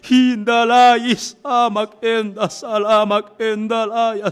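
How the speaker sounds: loud, fervent burst of tongues; praying in tongues. Real, not acted. Praying in tongues for the homes, loud ecstatic cry. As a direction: cry out ecstatic syllables, first one drawn out.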